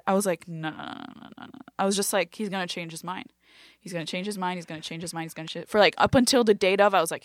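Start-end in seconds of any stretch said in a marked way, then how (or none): 0.5-1.6 very soft, rapid string of denials; quiet disbelief. Retold or imitated. Comedian refusing to believe the opening slot, quiet disbelief. Disbelief at big news, retold. 2.3-5.7 much softer, repetitive murmur; anxious self-talk. Retold or imitated. Comedian doubting the offer to herself, hushed worry.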